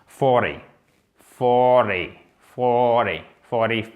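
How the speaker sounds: FORTY avec T presque comme un D, I classique; prononciation démontrée. Shown as a demonstration. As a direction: dis forty posément, R prononcé et T adouci en D. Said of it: "Vous prononcez le R, le T". (forty bien articulé, ton descendant)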